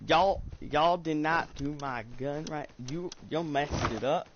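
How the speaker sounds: put-on Southern accent. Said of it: "I'm putting on my Southern accent".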